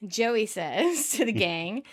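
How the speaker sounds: ordinary delivery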